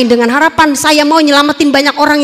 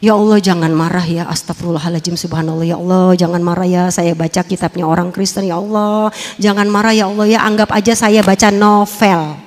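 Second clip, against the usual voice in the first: pleading prayer; 8 semitones lower than usual. Retold or imitated. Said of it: "doa saya".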